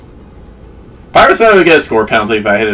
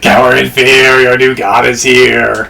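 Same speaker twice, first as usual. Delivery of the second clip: mad scientist; 5 semitones higher than usual. Pretend. Mock god threatens player.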